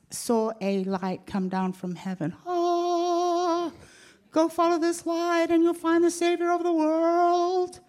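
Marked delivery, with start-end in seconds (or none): 2.4-7.8 high, loud proclaiming angel voice; heavenly announcement. Retold or imitated. Angel tells the shepherds to follow the light, loud and high. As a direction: call out the good news from heaven. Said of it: "it was like".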